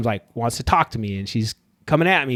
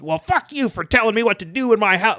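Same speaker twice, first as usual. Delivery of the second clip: a lightly voiced hostile neighbor, pitched higher; 6 semitones higher than usual. Neighbor refusing to turn down his TV, mock hostile. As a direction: snap it back like a neighbor who won't be told. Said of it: "if he goes".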